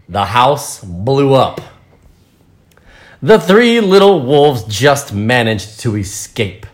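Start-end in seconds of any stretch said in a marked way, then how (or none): none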